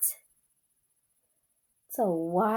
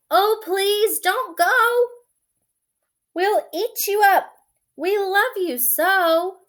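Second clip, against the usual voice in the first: higher, pleading monster voices; pleading wild things; 10 semitones higher, 5 dB louder than usual. Acted. The wild things beg Max to stay, voiced higher and pleading. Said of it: "the wild things cried".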